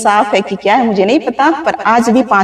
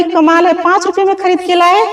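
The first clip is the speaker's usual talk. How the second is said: higher, playful mock astonishment; surprised; 7 semitones higher than usual. Retelling teasing her husband over five rupees, playfully surprised. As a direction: act amazed at his joke.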